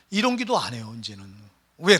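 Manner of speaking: ordinary talk, nothing marked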